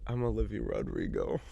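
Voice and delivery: a breathy whispered claim to fame; whispered joke. Pretend. Friend whispering a joke to her co-host, whispered. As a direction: whisper it like a secret.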